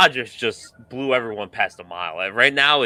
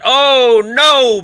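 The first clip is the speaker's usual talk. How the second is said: mock surprise; 11 semitones higher, 9 dB louder than usual. Catches his own wrong team.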